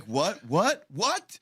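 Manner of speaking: retold bewildered reaction, climbing high; incredulous. Acting out baffled reaction to remark.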